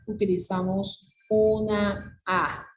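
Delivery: ordinary talk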